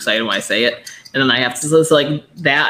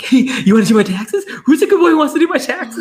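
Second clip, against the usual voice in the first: put-on high, loud baby-talk to the dog; much higher, louder good-boy voice; excited dog voice; 12 semitones higher, 7 dB louder, faster than usual. Shown as a demonstration. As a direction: fake thrill over something boring. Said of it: "a really excited voice".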